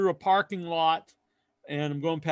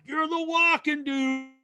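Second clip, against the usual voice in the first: put-on excited drunk fan; 10 semitones higher than usual. Drunk fan recognizing the local walker, high excited gushing. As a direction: gush like a tipsy frat boy spotting a celebrity. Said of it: "He's like".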